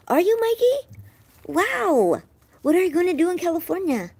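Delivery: high, loud, delighted astonishment; excited surprise. Real, not acted. Streamer asks Mikey about California, excited surprise. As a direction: pitch it way up, thrilled and amazed. Excited reaction to viewer's news.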